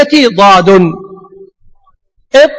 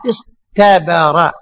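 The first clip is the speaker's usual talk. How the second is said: slow, drawn out; 6 semitones lower, 3 dB quieter, much slower than usual. Shown as a demonstration. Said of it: "أصل الطاء هذه تاء".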